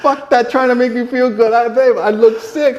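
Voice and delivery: retold scoffing comeback to wife; mock indignant retort. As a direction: brush off the flattery with a loud scoff. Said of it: "I go".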